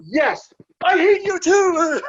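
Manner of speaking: loud, high, slow mock shout back at the fans; yelled reply. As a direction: shout it to the hecklers, hamming up the heel. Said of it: "So I'm like".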